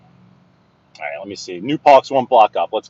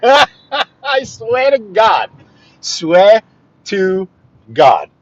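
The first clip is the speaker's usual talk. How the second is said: laughing; 10 semitones higher, 6 dB louder, much slower than usual. Real, not acted. Laughing at the other driver.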